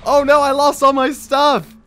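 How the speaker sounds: high, loud wail of loss; dismayed outcry. Real, not acted. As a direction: wail over everything you just lost. Died and lost items.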